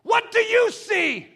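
very high, loud, slow demand; drawn-out pulpit shout; shouted challenge. Real, not acted. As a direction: cry the question out to the congregation. Preacher challenges congregation, then softens.

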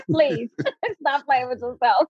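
a high laugh breaking into the words; real laugh. Real, not acted. Coach laughing at her own hype to the host. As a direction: laugh through the playful jab.

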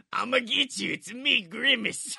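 lightly voiced creeping monster. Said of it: "eldritch horror Grimace".

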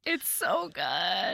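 slow, drawn-out swoon. Real, not acted. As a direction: savour every word dreamily. Delighted over the romance.

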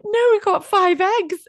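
high slow laughing voice; laughing while quoting. Real, not acted. Quoting the nurse to the co-host, high laughing voice. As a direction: quote the nurse through giggles.

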